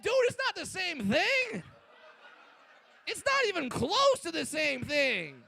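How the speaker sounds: high-pitched incredulous comic retort; exasperated protest. Retold or imitated. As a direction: protest in hammed-up disbelief, pitch jumping. Acted indignant retort to fan.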